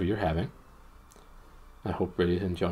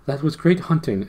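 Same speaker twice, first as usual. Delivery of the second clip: raised, louder prince's exclamation; exclaiming; 8 semitones higher, 7 dB louder than usual. A narrator's reading. Prince praises Puddleglum's hunting, exclaiming. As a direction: exclaim it high and loud, princely. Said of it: "exclaimed the prince".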